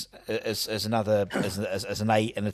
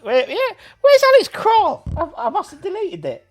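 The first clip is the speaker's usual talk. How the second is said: excited high exclaim; 6 dB louder than usual. Real, not acted. Searching for a missing player.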